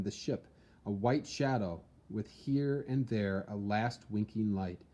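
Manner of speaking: plain reading voice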